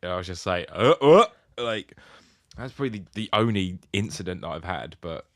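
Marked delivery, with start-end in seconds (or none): 0.7-1.2 startled grunts. Retold or imitated. Falling off a log drunk.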